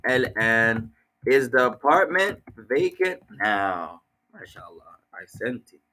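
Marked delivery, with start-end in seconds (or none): 0.1-4.0 1 slow translation. Shown as a demonstration.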